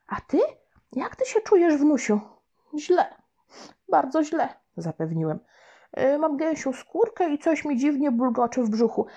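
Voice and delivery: high, put-on worried little boy; boy fibbing. Boy tells grandma on the phone that mum is ill, fibbing in a high worried voice. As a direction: sell the lie with a sad tone. Boy listing fake illnesses.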